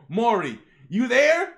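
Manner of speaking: loud, rising phone call. Acted. Grandpa calls for his grandson on the phone, loud call. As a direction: holler to check someone is there.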